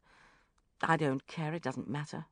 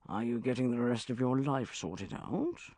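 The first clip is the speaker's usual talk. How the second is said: lower, confiding question rising at the end; concerned; 4 semitones lower than usual. A narrator's reading. Banker probing Bridget about her private life, concerned. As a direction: lean in with grave concern. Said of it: "he leaned forward in a concerned manner".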